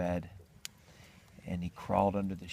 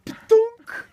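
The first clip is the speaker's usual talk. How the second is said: loud, high popped-out "thunk"; egg-drop thunk; 14 dB louder than usual. Acted. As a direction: imitate an egg shooting out. Imitated noise of egg dropping.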